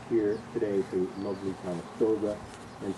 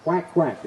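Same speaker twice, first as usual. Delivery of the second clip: duck quack; 6 semitones higher, 8 dB louder than usual. Acted. Narrator imitates duck.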